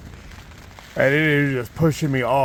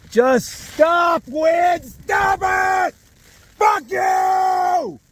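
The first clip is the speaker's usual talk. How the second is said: real, much higher and louder, yelling at the wind; furious shout; 8 dB louder, much slower than usual. Real, not acted. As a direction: scream your rage at the storm outside. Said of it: "Sorry, I'm frustrated".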